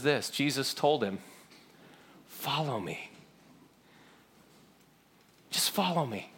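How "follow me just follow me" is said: very soft, sparse call with long pauses; gentle invitation. Acted. Jesus calling Peter back, quietly. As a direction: invite quietly and let each phrase hang.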